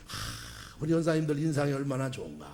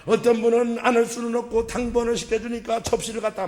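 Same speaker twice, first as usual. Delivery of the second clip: preaching at full force; 8 semitones higher, 8 dB louder than usual. Real, not acted. Preacher rousing the congregation, loud fervent preaching. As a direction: proclaim it to the whole congregation.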